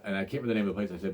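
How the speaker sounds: ordinary delivery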